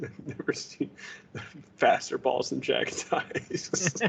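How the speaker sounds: slow breathy giggling voice; whispered laughing. Pretend. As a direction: giggle it out slowly. Laughing bit.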